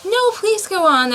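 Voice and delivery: high, pleading request; humble plea. A narrator's reading. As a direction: beg humbly. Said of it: "Alice said very humbly".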